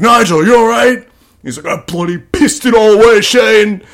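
hint of a British accent, upset; British kicker.

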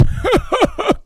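a short high laugh. Real, not acted. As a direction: laugh at the punchline. Laughs after the joke.

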